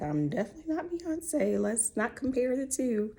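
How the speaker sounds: high, playful protest; mock protest. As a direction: laugh it off in a high voice. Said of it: "I'm like".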